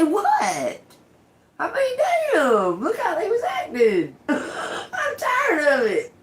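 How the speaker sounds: high, slow put-upon whine; whiny complaint. Pretend. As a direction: whine like a sulky kid to God. Acted complaint to God.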